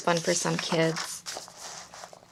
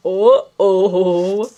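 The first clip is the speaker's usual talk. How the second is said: louder, rising uh-oh; 11 dB louder than usual. Shown as a demonstration. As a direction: build the uh-oh up. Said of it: "saying over and over again".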